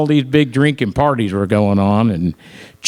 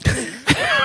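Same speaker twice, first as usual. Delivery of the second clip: high laugh; 5 dB quieter than usual. Real, not acted. Laugh after the punchline.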